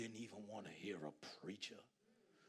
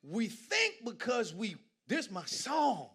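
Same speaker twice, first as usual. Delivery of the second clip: slow, much louder preaching; climactic preaching; 6 semitones higher, 11 dB louder, slower than usual. Acted. Preacher bringing sermon to climax, slow and loud. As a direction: hammer each phrase slowly.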